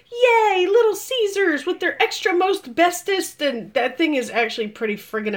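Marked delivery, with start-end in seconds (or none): 0.1-3.4 high-pitched hammed-up "yay"; mock cheer. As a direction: cheer it like a cheesy fan. Mock cheer for pizza chain.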